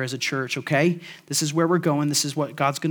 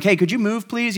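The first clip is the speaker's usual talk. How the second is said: mock polite plea to the grass, higher; gentle ask; 6 semitones higher than usual. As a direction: coax the grass aside, half joking. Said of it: "just gently being like".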